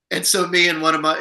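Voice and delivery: normal talking voice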